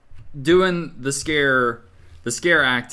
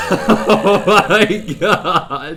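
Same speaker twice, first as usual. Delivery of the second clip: breathless stunned laugh; laughing in shock; 6 semitones higher, 7 dB louder, much slower than usual. Real, not acted. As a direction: laugh out in disbelief. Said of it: "I'm sweating".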